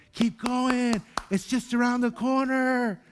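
high, bright encouraging call from the sidelines; cheering bystanders. Retold or imitated. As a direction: cheer the runner on, high and eager. Bystanders cheer runners near finish.

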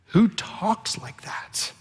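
slow awed whisper; slow, hushed, amazed whisper. Real, not acted. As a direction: breathe the question in wonder. Wondering aloud about Jesus.

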